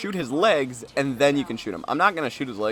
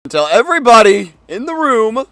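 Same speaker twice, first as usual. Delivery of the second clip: loud restart; 9 semitones higher, 11 dB louder than usual. Real, not acted. Restarts line loudly after interruption.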